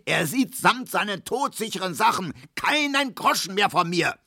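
hohe, erregte Schimpftirade; wütend schimpfend. A narrator's reading. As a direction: schimpf dich in Rage. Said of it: "brüllte Herr Markowski". (Markowski schimpft über Zidorn, hoch und erregt)

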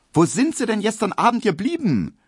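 the maid Hedwig's sharp reproach; unfriendly call. A narrator's reading. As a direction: scold him as he arrives. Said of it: "wenig freundlich".

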